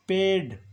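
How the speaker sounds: पेड sounded high and loud as paid; word said out clearly. Shown as a demonstration. Teacher announcing the word पेड loudly to the class. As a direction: hit पेड high and loud, long e in the middle. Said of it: "इसके बाद word है".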